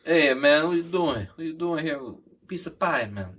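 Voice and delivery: playing a New York mobster in a heavy New York accent, lightly done. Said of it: "Yeah, the heavy New York shit".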